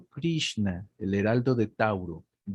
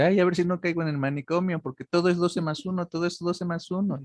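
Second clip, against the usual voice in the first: quick, higher-pitched imitation of a friend; lightly hammed-up higher voice; imitating Jean; 6 semitones higher, faster than usual. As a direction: retell it high and fast, as mock worry. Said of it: "Me dice".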